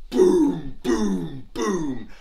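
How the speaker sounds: booming drum hits, first one loudest; imitated drum beats. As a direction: sound out a big war drum. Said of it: "And the drummers drummed".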